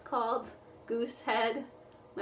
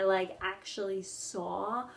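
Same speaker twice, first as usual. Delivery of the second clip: ordinary talk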